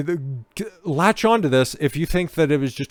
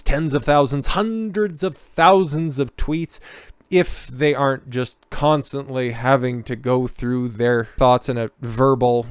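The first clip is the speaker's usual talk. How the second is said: ordinary delivery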